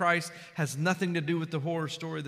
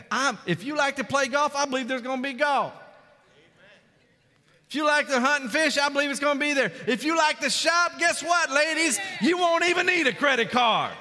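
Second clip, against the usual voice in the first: higher and louder, climbing to a joyful shout; preaching at full force; 6 semitones higher, 5 dB louder than usual. Acted. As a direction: preach it big to the ladies, rising.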